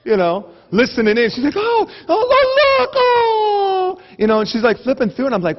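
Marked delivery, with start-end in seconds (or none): 1.6-4.0 very high wailing squeal, drawn out; mock freaking out. Lady overcome by a magazine picture, mock freak-out. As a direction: coo and wail as if overcome, hammed-up. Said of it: "she's just freaking out".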